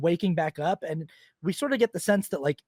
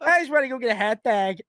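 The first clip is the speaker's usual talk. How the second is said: a higher, louder, hammed-up Chicago accent; mock Chicago accent; 6 semitones higher, 4 dB louder than usual. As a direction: cheer for the Bears like a Chicago local. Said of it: "is all of a sudden like".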